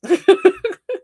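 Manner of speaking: very high, loud laugh; real laugh. Real, not acted. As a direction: burst out laughing at the cards. Laughing at the cards.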